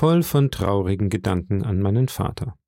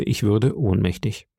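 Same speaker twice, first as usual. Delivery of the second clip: ordinary delivery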